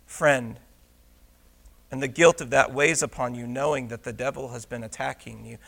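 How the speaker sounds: low, soft pastoral delivery; hushed preaching. Real, not acted. Preacher naming the congregation's hidden attacks, hushed and low. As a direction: drop the voice and speak to each private struggle.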